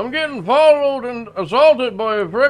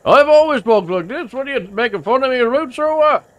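put-on thick rural accent; 4 dB louder than usual. Local insists this is how he always talks, thick rural accent. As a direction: lean hard on the rural accent and the boy.

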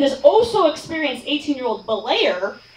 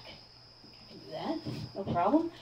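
whispered self-reassurance; 5 semitones lower, 12 dB quieter than usual. Retold or imitated. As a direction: act out a barely audible pep talk. Retold self-talk before jumping.

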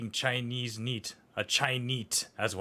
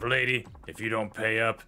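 ordinary talk, nothing marked

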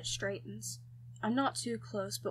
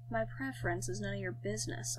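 usual speaking voice

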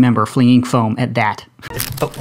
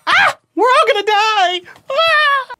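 high shrill mock panic scream; panicked screaming; slower than usual. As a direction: scream like doom is here.

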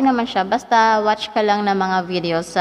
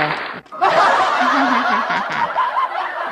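canned laugh track; 12 semitones higher than usual. Acted. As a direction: play a sitcom laugh sample. Laughing sound effect.

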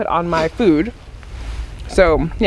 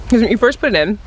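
chewing between words; talking while chewing. Real, not acted. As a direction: mouth partly full of seaweed. Seen 1: put seaweed in mouth at -5, jaw working, hand at mouth.

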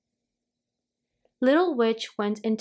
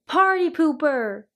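higher-pitched mock shriek; mild storybook shriek; 6 semitones higher, 4 dB louder than usual. A narrator's reading. Aunt Grouchy jeering at Little Witch, mild shriek. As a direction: jeer it as a contained shriek. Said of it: "shrieked Aunt Grouchy".

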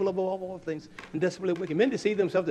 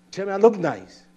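louder pleading wife voice; voicing his wife; 5 semitones higher, 10 dB louder than usual. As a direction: demand it like the wife. Wife demanding compliment.

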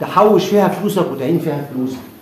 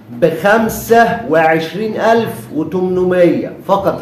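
slow price announcement; 3 semitones higher, 4 dB louder, much slower than usual. Real, not acted.

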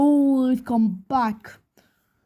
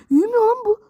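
whiny frustrated cry; 10 semitones higher, much faster than usual. Real, not acted. Character stuck, frustrated.

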